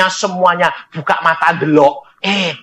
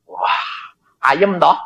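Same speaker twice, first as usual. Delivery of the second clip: normal talking voice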